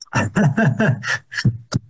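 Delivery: short knowing laugh; chuckle. Real, not acted. Analyst describing officials' sidelong looks, chuckling. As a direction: chuckle to yourself mid-thought.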